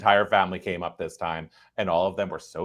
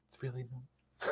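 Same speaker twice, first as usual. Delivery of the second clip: choked-up whisper; 5 semitones higher, 19 dB quieter than usual. Retold or imitated. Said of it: "I was just like".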